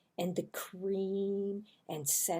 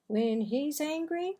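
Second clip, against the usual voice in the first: ordinary delivery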